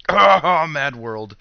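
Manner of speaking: ordinary talk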